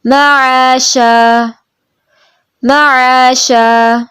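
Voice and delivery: slow melodic tajweed chant; Quran recitation. Shown as a demonstration. Teacher modelling the verse for the student in slow chant. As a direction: recite slowly, drawing out each vowel.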